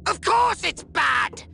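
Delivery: slow exasperated shout; exasperated outburst. Acted. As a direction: blow up at the idiot. Leader exasperated at dim clone.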